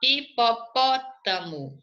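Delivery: "Hipopótamo" slowly, in separate syllables, stress on PÓ. Shown as a demonstration. Teacher sounding out a word for pupils, "Hipopótamo" slow, stressed on PÓ. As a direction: spell out "Hipopótamo" by syllables, landing on PÓ. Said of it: "antepenúltima".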